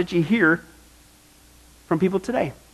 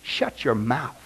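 stern rebuke; 8 semitones lower, 7 dB quieter, much slower than usual. Retold or imitated. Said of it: "what he would say to those folks".

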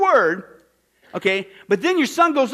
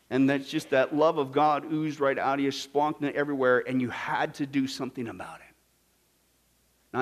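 usual speaking voice

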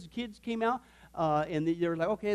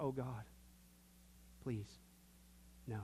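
fading pleading whisper; 7 semitones lower, 17 dB quieter than usual. Acted. David pleading, fading softer.

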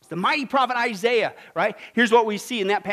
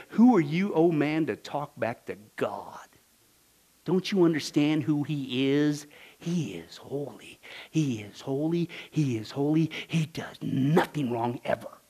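slow, low, hushed reverence; solemn rebuke; 5 semitones lower, slower than usual. Acted. Preacher quotes Paul on God's holiness, solemn rebuke. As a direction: press each phrase slowly and gravely. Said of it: "what Paul says in Romans".

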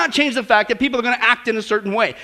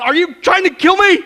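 high, loud alarmed outcry, slowing at the end; panicked boy shouting at the psychologists; crying out; 7 semitones higher, 9 dB louder than usual. Acted. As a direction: yell it like a terrified kid. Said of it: "the negative boy cries out".

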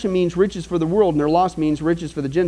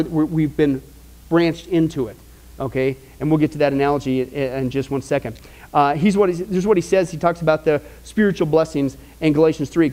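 ordinary delivery

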